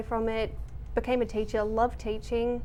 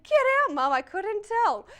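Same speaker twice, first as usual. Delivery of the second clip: mock amazement; 8 semitones higher, 3 dB louder than usual. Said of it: "It's like".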